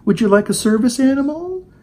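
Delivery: put-on high-pitched cooing at a small child; mock patronizing. Commentator mimics people coddling the witness, mock patronizing. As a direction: offer comfort in sugary mock baby talk, questions lilting up. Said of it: "they patronized her".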